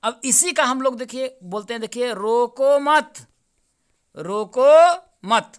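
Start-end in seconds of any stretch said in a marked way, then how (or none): 2.1-3.3 loud, high, slow रोको मत without a break; emphatic demonstrated रोको मत, held together; रोको मत as one. Shown as a demonstration. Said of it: "यहाँ विराम लिया". 4.2-5.5 very loud, high, drawn-out रोको मत, then stop; long stretched रोको, landing on मत; pause after मत. Shown as a demonstration. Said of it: "यहाँ विराम लिया".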